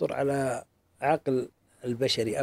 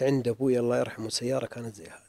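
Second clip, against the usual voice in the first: mild whispered quote; 4 semitones lower, 5 dB quieter than usual. Retold or imitated. Retelling an old woman crying.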